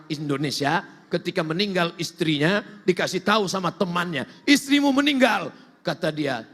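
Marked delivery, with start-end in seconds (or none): 4.5-5.5 announcing bad news. Friend breaks news to husband.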